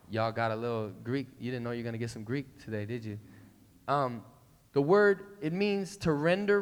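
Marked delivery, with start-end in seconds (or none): none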